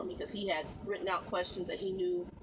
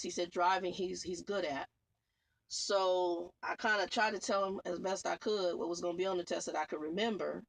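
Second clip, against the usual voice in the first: ordinary talk, nothing marked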